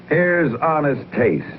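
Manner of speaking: normal talking voice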